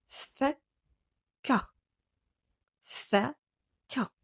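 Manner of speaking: Fakka said clearly for pupils to echo; model for repetition. Shown as a demonstration. Teacher leading class repetition of Arabic word. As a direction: say Fakka so the class can repeat it. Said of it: "Répétez après moi".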